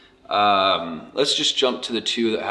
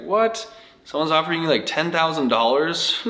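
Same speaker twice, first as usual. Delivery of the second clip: higher-pitched incredulous questioning; retold disbelief; 7 semitones higher than usual. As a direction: re-enact your amazement at a huge offer. Retelling his amazed reaction to offer.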